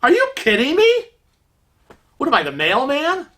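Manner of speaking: high, incredulous outburst; can't believe what he's hearing; indignant disbelief. Retold or imitated. Husband fuming at the clerk's suggestion, incredulous. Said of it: "he's like".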